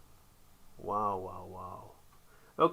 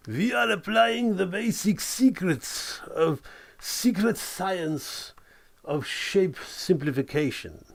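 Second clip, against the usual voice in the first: high, hammed-up German professor accent; German genius accent; 6 semitones higher than usual. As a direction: lecture like a cartoon German genius. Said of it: "I don't know why I used that accent".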